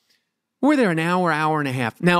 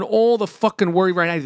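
ordinary talk

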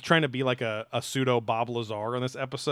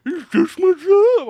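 much higher, much louder and slow; mock nonchalant witness; 14 dB louder, slower than usual. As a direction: stretch it out in a loud, high parody. Mocking T D Barnes's attitude.

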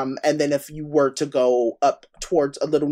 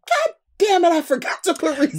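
mock frustrated outburst; 12 semitones higher than usual. Annoyed with herself leaving the frozen yogurt shop, high mock outburst. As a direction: yelp it like you just remembered too late. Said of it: "I was mad".

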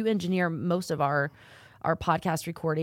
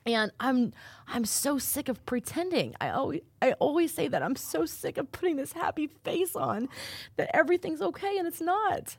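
tearful; 9 semitones higher than usual. Real, not acted. Host admits to his guest he is sick of pretending, tearful. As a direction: say it while fighting tears. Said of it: "I'm in tears".

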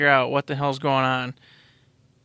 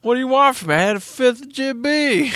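put-on drunk whine; 9 semitones higher than usual. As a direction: whine it, sloshed and defensive. Acting drunk gamers bickering.

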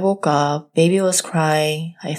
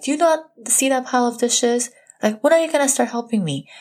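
higher, irritated retold nag about the dishes; retold angry complaint; 6 semitones higher, faster than usual. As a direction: throw the complaint at him, pitched up. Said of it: "a form of anger".

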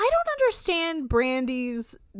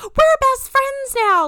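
much higher, louder, slow squealing voice; mocking a housemate who is too eager for friends; mock gushing; 10 dB louder, slower than usual. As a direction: gush like a bubbly camper. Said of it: "the idea of being like".

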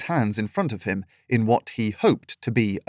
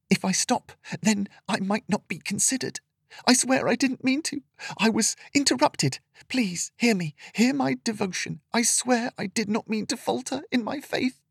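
tearful pleading; 11 semitones higher, 3 dB quieter than usual. A narrator's reading. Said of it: "tears well up".

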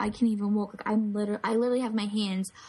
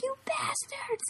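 high, slow, hushed squeal; mock outrage; 5 dB quieter, slower than usual. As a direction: squeeze it out high in mock betrayal. Cursing friends who set them up.

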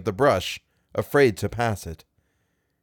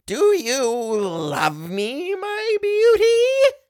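shrieking madman, very slow and high; leering maniac; much slower than usual. A narrator's reading. Maniac leers at Wang's kneeling wife, crying out. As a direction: draw it out into a crazed, rising screech. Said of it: "the maniac leered at her and cried out".

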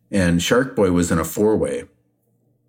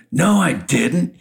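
hot denial; 8 semitones higher, slower than usual. Retold or imitated. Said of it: "he gets hot with Shark Boy".

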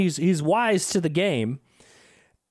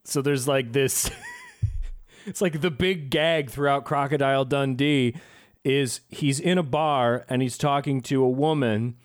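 ordinary talk, nothing marked

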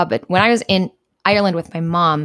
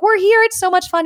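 excited texting; 11 semitones higher than usual. Retold or imitated. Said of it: "I'm like".

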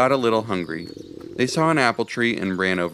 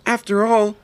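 high, bright-eyed squirrel voice; squeaky little critter full of hope; hopeful squirrel; 11 semitones higher, 4 dB louder than usual. Acted. Charlie reassuring Ivy, hopeful squirrel voice.

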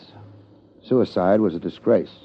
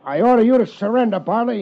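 higher, fast shouted order; commanding shout; 13 semitones higher than usual. Acted. Detective orders the hidden trainer to surrender, shouting. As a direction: bark the order across the road. Gunfight shouting across road.

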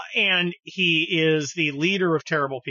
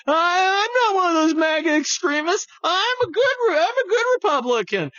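high, mock whiny Republican; hammed-up squeaky simpering voice; mocking moderate Republican; 12 semitones higher, 3 dB louder than usual. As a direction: parody a timid Republican. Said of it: "a bunch of Republicans out there going".

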